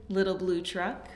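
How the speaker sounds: ordinary talk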